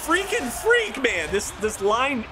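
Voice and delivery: squeaky high freaking freak, cracking up in disbelief; exasperated excitement. Real, not acted. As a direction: voice jumps way up at the rival's goal. Rival scores a gorgeous goal.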